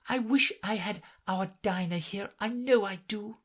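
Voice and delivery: put-on girl speaking aloud. A narrator's reading. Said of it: "said Alice aloud".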